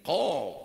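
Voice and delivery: "Kof" said loudly from the throat; correct kof. Shown as a demonstration. Ustaz showing students the right kof, loud. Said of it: "Pakai ini".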